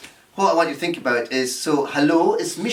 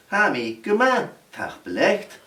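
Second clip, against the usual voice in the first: usual speaking voice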